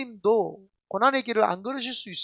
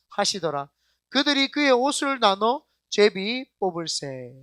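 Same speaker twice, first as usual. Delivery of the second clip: loud, slow, measured recitation; congregational scripture reading; 6 dB louder, slower than usual. Acted. Pastor reads Luke aloud with congregation, slow recitation. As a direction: lead everyone through the verse, drawn out and loud.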